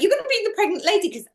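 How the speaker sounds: put-on high, rapid childish insistence; bossy child. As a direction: imitate a kid laying down the rules fast. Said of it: "And I was like".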